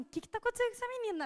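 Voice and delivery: quick high bewildered question, a bit quiet; mock-bewildered friend wondering what's wrong; puzzled friend. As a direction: ask fast and high, baffled by the girl. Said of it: "até ela tava tipo assim".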